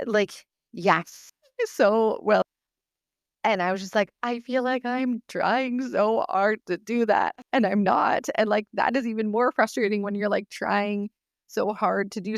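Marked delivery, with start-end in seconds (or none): 4.2-8.3 mildly strained retold protest, higher and drawn out; slow, raised pitch, retelling exasperation; frustrated protest. Speaker retelling her reply after bursting into tears, mildly frustrated.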